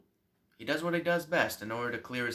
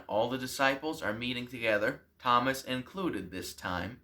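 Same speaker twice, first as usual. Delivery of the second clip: ordinary talk, nothing marked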